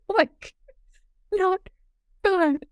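imitating a tearful, high-pitched squeak; high mock sob, words broken up; mock crying. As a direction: squeak it out as if bawling. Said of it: "I'm, like, crying".

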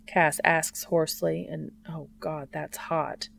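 plain reading voice

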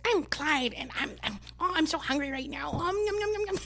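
lightly voiced hungry dog; cutesy pet voice for the dog. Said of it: "his voice is".

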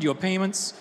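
usual speaking voice

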